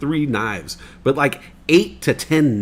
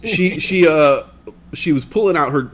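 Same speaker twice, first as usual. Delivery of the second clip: ordinary delivery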